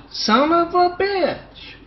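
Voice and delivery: high, drawn-out acted cry of dismay; outraged exclamation. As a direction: stretch it out high in mock outrage. Quoted student reacts to shaved head.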